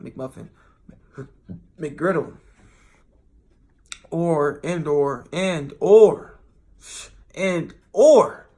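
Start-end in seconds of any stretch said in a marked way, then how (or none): 4.1-5.2 drawn-out, musing "and or"; "and or" said slow and deliberate. Shown as a demonstration. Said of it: "or I say and too". 5.3-8.5 "and or" blurted out much louder; "and or" loud, jokey insistence. Shown as a demonstration. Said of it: "or I say and too".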